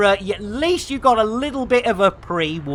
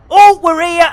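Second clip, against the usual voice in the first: excited; 10 semitones higher, 9 dB louder than usual. Real, not acted. Excited arrival exclamation.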